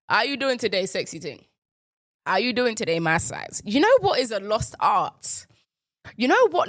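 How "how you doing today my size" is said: usual speaking voice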